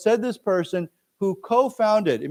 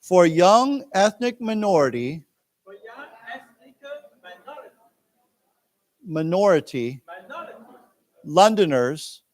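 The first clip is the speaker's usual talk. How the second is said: slow, deliberate reading for learners; teacher reading a news text to students; slow read-aloud; 2 semitones higher than usual. Shown as a demonstration. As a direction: read it out slowly with long pauses. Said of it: "One more time".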